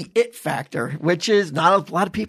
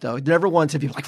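usual speaking voice

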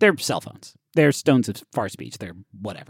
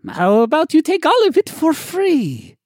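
very high, mock-generous shopkeeper; dripping sarcasm, pitched way up; sarcastic offer; 4 dB louder than usual. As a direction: squeal a fake bargain at a haggler. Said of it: "This was sarcasm".

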